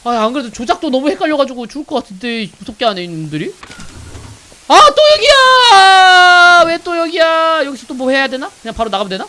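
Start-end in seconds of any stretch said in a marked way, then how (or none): 4.7-7.6 loud, high, drawn-out cry of dismay; exasperated wail. Real, not acted. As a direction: wail it long and loud at being back. Looped back to same room again.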